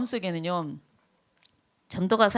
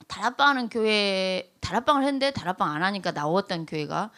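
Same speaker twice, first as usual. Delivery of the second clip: usual speaking voice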